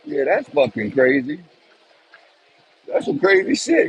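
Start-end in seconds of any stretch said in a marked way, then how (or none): 2.9-3.9 real amazement. Real, not acted. Amazed after the incident.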